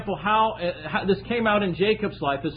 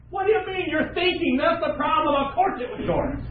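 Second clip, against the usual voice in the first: indignant retort; 8 semitones higher than usual. Acted. Acted accuser retort.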